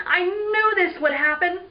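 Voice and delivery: high-pitched exclamation of dismay; crying out. Acted. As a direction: wail that you saw trouble coming. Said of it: "Neil cried".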